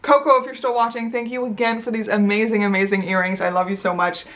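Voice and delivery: ordinary talk, nothing marked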